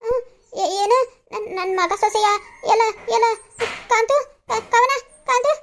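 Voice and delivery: put-on high, loud, stammering old mother; hammed-up frail mother calling for Kantu and Kavana; feigned frail waking. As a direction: play the dazed sick mother calling her son.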